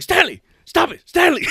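high, loud shout with laughter underneath; screaming out. Retold or imitated. Telling off an enraged coworker, loud laughing shout. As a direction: yell his name to stop him. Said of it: "Just screaming out".

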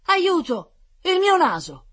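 high, loud cry for help; alarmed cry. A narrator's reading. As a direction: yell out in shock at the mirror. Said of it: "nel guardarsi allo specchio gridò".

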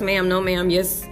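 normal talking voice

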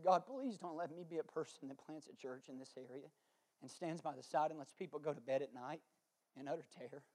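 low, soft, subdued plea to God; retold prayer. Pastor retelling last night's prayer to God, hushed plea. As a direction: pray it quietly, held well down. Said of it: "approach last night in my prayer".

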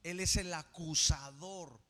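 ordinary delivery

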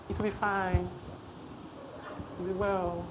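mock-meek, very soft murmur; barely-there mild reassurance; too gentle. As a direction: imitate a timid, gentle confession, dragged out softly. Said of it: "you are too gentle".

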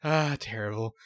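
usual speaking voice

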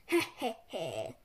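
low, forced chuckle; acted laugh. A narrator's reading. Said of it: "laughed Harold".

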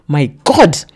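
put-on jealous boyfriend suddenly shouting at her. A narrator's reading. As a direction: explode out of nowhere. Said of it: "he suddenly shouts".

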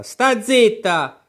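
a purple-faced queen silencing her; angry queen. Acted. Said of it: "facendosi paonazza".

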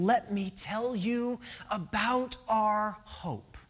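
slow, deliberate, spacing out the words; slow proclamation. Acted. Preacher voicing Mark to struggling believers, slow and deliberate. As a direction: announce it slowly, weighing each word. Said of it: "here is Mark saying".

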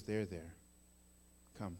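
low, hushed fatherly comfort; tender soothing. Acted. Father to repentant child, tenderly soothing. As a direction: softly console and welcome.

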